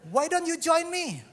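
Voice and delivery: high, slow friendly invitation; football captain invites new boy, high and slow; upbeat captain invite. Retold or imitated. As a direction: invite him in a bright cheerful voice. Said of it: "Terus dia bilang".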